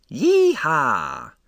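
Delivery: whooping party holler, high and loud; rowdy yell. Acted. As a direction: let out a wild cowboy whoop. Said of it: "someone in his living room yelled".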